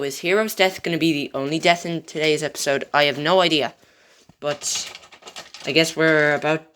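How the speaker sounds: usual speaking voice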